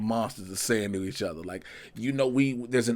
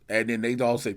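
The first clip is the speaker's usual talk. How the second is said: usual speaking voice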